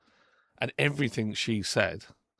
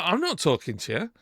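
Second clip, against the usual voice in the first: angry retort; 6 semitones higher, 3 dB louder than usual. Retold or imitated. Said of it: "by this time I'm getting angry".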